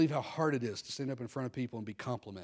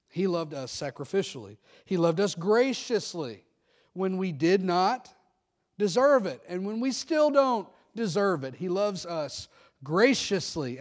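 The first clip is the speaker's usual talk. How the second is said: louder and slow, pressing each phrase; fervent preaching; 5 semitones higher, 7 dB louder, slower than usual. Real, not acted. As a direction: preach it slowly with full conviction. Preaching at full force.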